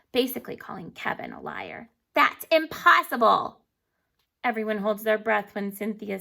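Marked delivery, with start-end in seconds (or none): 2.1-3.6 loud, slow know-it-all declaration; bossy certainty. A narrator's reading. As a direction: lay it down as final fact. Bossy girl declares it impossible.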